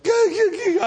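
high, loud imitated sobs; hammed-up blubbering cry; mock bawling. New father handed his little girl, mock bawling. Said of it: "I was just, I was bawling".

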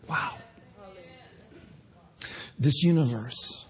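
hushed reverent sermon close; tender preaching. Acted. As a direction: gently draw them into awe. Soft closing reflection.

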